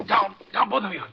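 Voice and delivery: shot, yelling them down; hit and warning. Acted. Hero is shot.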